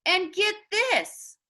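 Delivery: slow, eager lead-in with a lifted pitch; eager kid spilling a secret. A narrator's reading. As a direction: savour the news like a kid who can't wait. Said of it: "said Miguel eagerly".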